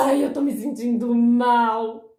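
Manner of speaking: loud, drawn-out mock wail; hammed-up woe-is-me complaint; self-pitying moan. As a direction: exaggerate the whine, dragging each word. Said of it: "você não pode mais falar".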